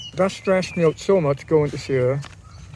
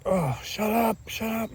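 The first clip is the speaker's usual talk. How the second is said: muttered shut up; 6 semitones higher, 5 dB quieter, slower than usual. Retold or imitated. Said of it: "In my mind, I'm like a crazy person".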